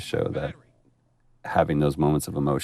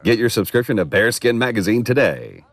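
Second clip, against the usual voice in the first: mock ad read; 6 semitones higher, 5 dB louder than usual. Mock ad pitch.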